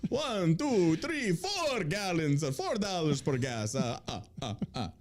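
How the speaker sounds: cartoon counting villain with staccato laugh. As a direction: count grandly, then punctuate with "ah, ah, ah". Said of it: "the count is purple".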